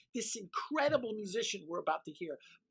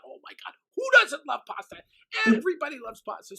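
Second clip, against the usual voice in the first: gushing; 4 semitones higher, 6 dB louder, faster than usual. Acted. Gushing about pasta.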